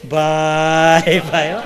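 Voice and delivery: long, lingering comic exclamation; drawn-out exclamation. Real, not acted. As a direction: stretch the first word very long on a held note. Comedian's drawn-out exclamation after a joke. (લાંબો ખેંચેલો ઉદ્ગાર)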